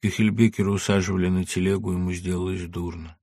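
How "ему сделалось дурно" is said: plain reading voice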